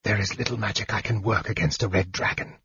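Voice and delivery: fast, hushed whispering; soft, rapid, breathy undertone; swift whisper. Acted. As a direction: whisper it quickly. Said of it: "Raistlin whispered swiftly".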